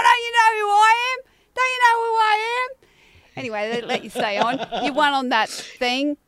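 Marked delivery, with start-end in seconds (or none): 0.0-2.8 high-pitched indignant shout, imitated; mock-outraged celebrity cry, pitched way up; Bev. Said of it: "And then Bev's going".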